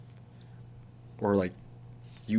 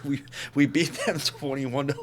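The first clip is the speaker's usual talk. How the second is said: usual speaking voice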